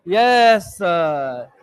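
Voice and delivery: slow, drawn-out mock chorus of students; mock students' chorus. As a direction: drag it out like a bored class. Teacher voices the class answering. (धीमे, खिंचे स्वर में छात्रों की नकल)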